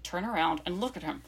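low, spaced-out repeated instruction; deliberate repeat. Retold or imitated. Hero repeating himself to the puzzled girl, low and deliberate.

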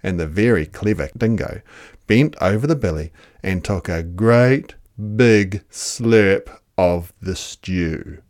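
usual speaking voice

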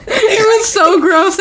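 high, slow, laughing disgust; real laughter in the voice. Real, not acted. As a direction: laugh through the words, grossed out. Laughing over the gross quote.

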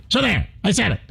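a hint of a combative radio host; lightly voiced Mark Levin, defiant; Mark Levin impression. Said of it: "That's my Mark Levin imitation".

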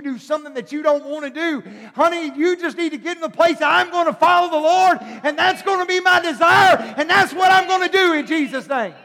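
loud, high preaching shout; full-force preaching. Acted.

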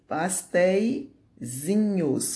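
"pasteizinhos" drawn out in separated parts; stretched, broken-up "pasteizinhos"; slow dictation. Shown as a demonstration. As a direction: dictate "pasteizinhos" slowly, pausing before the ending. Teacher dictates the diminutive in parts.

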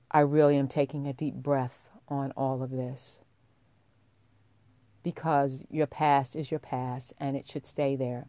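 ordinary talk

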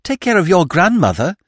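put-on a girl retorting on the tree branch; cheeky retort. A narrator's reading. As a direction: snap back at him like a saucy girl. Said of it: "retorted the girl".

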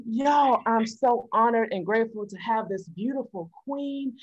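ordinary talk, nothing marked

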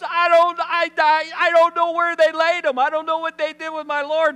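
high, loud, stammering fluster; hammed-up frantic pleading; distressed outburst. Mary tells angels her Lord is missing, loud mock distress.

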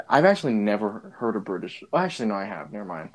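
normal talking voice

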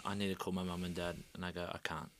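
low, very soft, sinking voice; hushed, heavy-hearted retelling; subdued realisation. Retold or imitated. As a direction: let it drop away almost to nothing. Retelling realising parents are gone.

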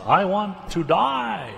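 high, slow mock lament; dramatic Jonah. Preacher mimicking Jonah's dramatic cry, slow and high. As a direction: ham up a sulking prophet's wish to die. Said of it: "dramatic statements that Jonah makes".

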